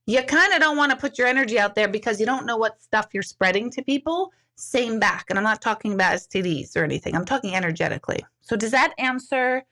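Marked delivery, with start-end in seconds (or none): none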